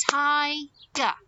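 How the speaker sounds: "Tiger" split into two clapped beats; syllable by syllable. Shown as a demonstration. Teacher claps syllables with children, "Tiger" in two beats. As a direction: break "Tiger" as Ti, pause, ger. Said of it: "clap how many syllables we can hear".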